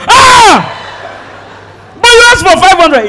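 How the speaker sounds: high, loud surprised cry; mock shock. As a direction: gasp it out in mock disbelief. Woman's shocked reply, acted.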